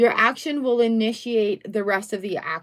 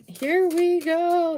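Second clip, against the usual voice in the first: slow, higher "here we go"; drawn out; 8 semitones higher, much slower than usual. Real, not acted. Reader starts the card spread for the viewer. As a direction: stretch it out, eager.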